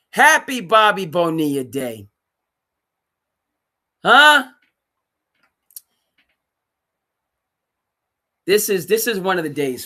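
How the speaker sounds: loud, high celebratory shout; excited sports-host cheer; gleeful. Real, not acted. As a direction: whoop it out like a holiday.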